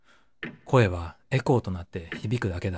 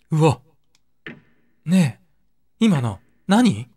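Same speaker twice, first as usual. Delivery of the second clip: loud, higher, slow alarmed question; frightened surprise, raised voice; startled; 5 semitones higher, 6 dB louder, slower than usual. Acted. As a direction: jump back and ask what that was. Something glimpsed in the tank.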